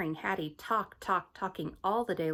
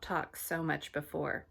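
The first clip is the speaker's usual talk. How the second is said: usual speaking voice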